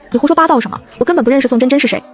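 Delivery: ordinary delivery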